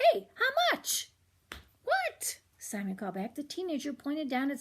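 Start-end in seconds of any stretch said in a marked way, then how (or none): 0.1-1.1 put-on high, light teen voice calling across the driveway; teenager calling out. 1.8-2.4 high, puzzled call; kid calling back. Acted. Simon calls back.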